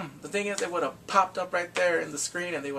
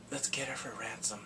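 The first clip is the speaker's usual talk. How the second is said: soft, conspiratorial whisper; scheming whisper; 4 semitones lower, 9 dB quieter than usual. Retold or imitated. Kidnapper proposing ransom to his accomplice, scheming whisper. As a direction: whisper it like a secret plot.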